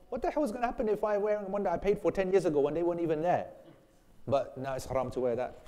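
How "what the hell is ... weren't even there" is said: mock-indignant, higher-pitched protest; indignant retort. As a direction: argue back in exasperated disbelief. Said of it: "I go".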